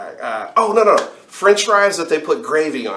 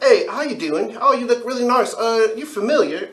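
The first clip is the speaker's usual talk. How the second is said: much higher, sweet imitation of a tough guy being polite; high mock gentle voice; 10 semitones higher than usual. Storyteller imitating the tough guy's sweet greeting, much higher. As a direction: mimic the gangster dude turned sweet and high. Gangster dude greeting in French accent.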